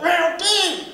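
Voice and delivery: high, hammed-up "Round two!"; mock eager shout. Comic hamming up his eager bedroom cheer. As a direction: cheer it like a cheesy lover. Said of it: "I would never talk like that".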